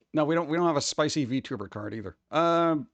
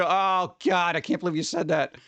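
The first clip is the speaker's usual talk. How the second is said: ordinary delivery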